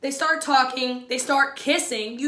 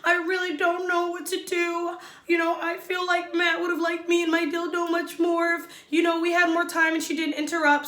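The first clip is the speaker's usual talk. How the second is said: higher whiny imitation of Katie; mock-upset contestant complaining; imitating Katie; 5 semitones higher than usual. Vlogger imitating Katie venting to the girls, higher and whiny. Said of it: "she's like".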